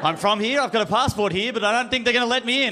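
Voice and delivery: hammed-up traveler's nerves; mock panic. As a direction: fret your way to the customs desk. Anxious Americans waiting at customs.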